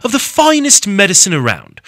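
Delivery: loud, high mock English accent. Shown as a demonstration. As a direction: read the ad copy in a hammed-up English accent. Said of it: "I can do an English accent".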